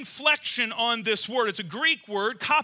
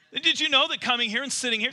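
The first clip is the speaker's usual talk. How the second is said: ordinary talk, nothing marked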